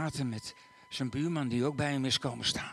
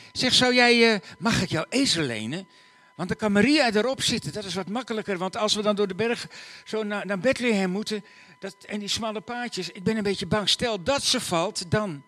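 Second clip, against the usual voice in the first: higher, louder worried plea; anxious request; 8 semitones higher, 7 dB louder than usual. Acted. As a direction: afraid she'll fall. Joseph asks neighbor anxiously.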